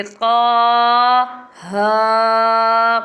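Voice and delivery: long drawn qaaf-alif and haa, recited loud; held letter names, chanted; Quran recitation drawn out. Shown as a demonstration. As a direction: recite each letter stretched, tajweed style. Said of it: "الگ الگ کر لیں گے".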